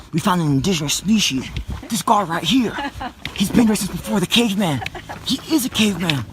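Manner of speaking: hammed-up Australian explorer on a big discovery; Australian accent. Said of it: "Can you speak in an Australian accent".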